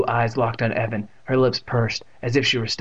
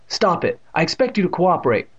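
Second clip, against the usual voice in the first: mildly cross, raised pitch; angry; 6 semitones higher than usual. A narrator's reading. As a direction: scold him with restraint. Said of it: "Miss Ross shouted angrily".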